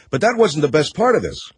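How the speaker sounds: ordinary talk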